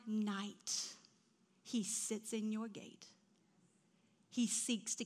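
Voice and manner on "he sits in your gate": normal talking voice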